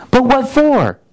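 put-on a startled boy blurting it out; astonished Shasta.

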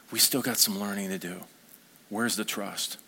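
low, soft, gentle correction; good parent. Acted. Good rabbi to his learners, gently correcting. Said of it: "like a good parent or a friend".

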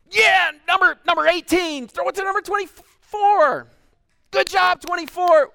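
high, loud fan shouts; stands shouting. Retold or imitated. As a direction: call plays from the bleachers. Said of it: "shout at the top of our lungs".

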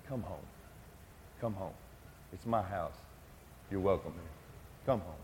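very soft, low, tender calling; soft invitation. Acted. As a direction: gently call the lost home.

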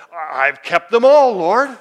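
mock boastful protest; rich young ruler. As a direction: act out a man proudly declaring his record. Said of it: "the rich young ruler came up to Jesus and".

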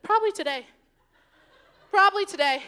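amused, chuckling answer; laughing reply. Retold or imitated. The speaker laughing as she answers the student. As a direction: laugh it off lightly.

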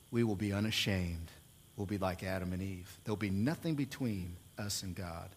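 low, very soft sermon voice; soft and low, letting it settle; hushed awe. Real, not acted. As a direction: let it sink to a quiet, reverent close. Hushed close after preaching climax.

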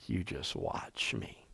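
hushed, near-whispered warning; low, very soft menace with long pauses; scoffing. Acted. God answering rebels who defy him, quiet scoffing. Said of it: "he moves from laughter to scoffing".

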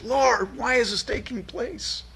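high anguished lament; anguished cry. Acted. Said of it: "where Habakkuk's heart is".

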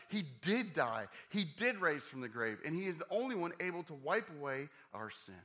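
high, emphatic preacher's proclamation; urgent pulpit declaration; fervent preaching. Real, not acted. Preaching with conviction.

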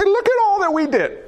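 high-pitched mock boasting; imitating a self-righteous appeal; boastful plea. Acting out people boasting to Jesus.

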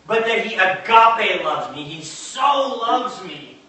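insistent preacher, driving home each key word; impassioned preaching. Real, not acted. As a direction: preach it with mounting conviction.

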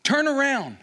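high, loud cry of turn around; pleading cry. Retold or imitated. Moses begging God to turn around, loud cry. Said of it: "as he says".

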